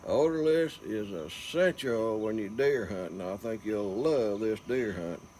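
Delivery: usual speaking voice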